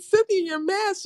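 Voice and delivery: high, giggly reminder; amused. Retold or imitated. Telling Cynthia about her mask, amused and high. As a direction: point it out while laughing.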